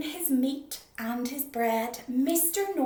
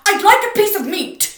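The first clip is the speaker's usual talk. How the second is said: huge boom, far louder and higher; 10 semitones higher, 20 dB louder than usual. Acted. Mister Noisy booming at the butcher again. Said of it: "he boomed".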